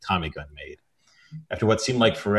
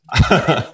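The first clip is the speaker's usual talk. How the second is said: burst of laughter; 7 semitones higher, 6 dB louder than usual. Real, not acted. Reader cracks up at own line.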